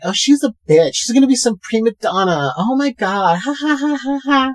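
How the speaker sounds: high, catty gossip voice; mocking gossipy girls. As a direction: mimic mean girls, rising into a mock laugh. Mimicking gossiping girls in locker room.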